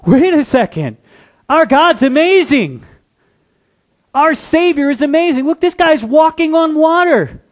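acting out disciples' awe; excited amazement. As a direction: burst out in wonder at him. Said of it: "and go".

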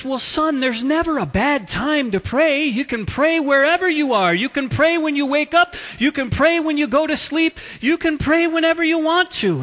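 lightly voiced priest, high and effusive; encouraging priest. Priest reassures grandly in the joke.

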